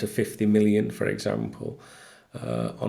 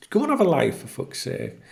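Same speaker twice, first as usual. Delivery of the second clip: high, fed-up jab ending in a hiss; real exasperated scorn; exasperated rant; 7 semitones higher than usual. Real, not acted. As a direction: dismiss him with scorn. Retorting to a critic.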